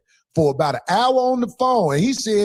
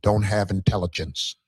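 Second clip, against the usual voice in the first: lower, measured proper speaker; proper-talk voice; 11 semitones lower, 3 dB quieter than usual. Shown as a demonstration. [name] shows how he could sound to the guys, lower and proper. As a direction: put on a lower, educated voice. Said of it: "I don't talk like this".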